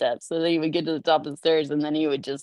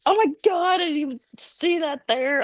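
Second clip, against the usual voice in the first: higher startled exclamation; mild mock start, higher pitch; 10 semitones higher than usual. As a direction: jump at the coat rack. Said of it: "I'm like".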